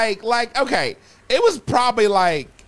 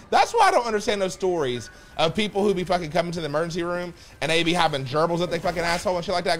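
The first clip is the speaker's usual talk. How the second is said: usual speaking voice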